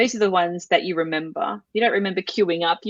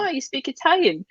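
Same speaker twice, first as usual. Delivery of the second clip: higher-pitched imitation of a surprised official; pleasantly surprised; 7 semitones higher than usual. Acts out surprised immigration officers.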